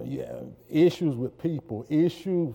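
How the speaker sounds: ordinary delivery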